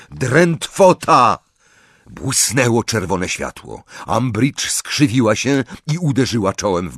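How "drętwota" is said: high, loud, drawn-out incantation; called-out spell. A narrator's reading. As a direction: shout the spell slowly and forcefully. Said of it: "i zawołał".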